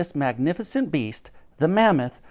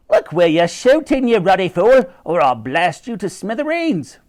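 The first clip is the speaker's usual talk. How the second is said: higher, louder furious rant; Lady Buckshot scolds the professor, loud and high; angry cry; 5 semitones higher, 6 dB louder than usual. A narrator's reading. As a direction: blast him like an outraged lady. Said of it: "she cried".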